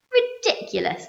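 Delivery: high, loud scoffing; indignant shout. A narrator's reading. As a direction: shout the plan down. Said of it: "they shouted".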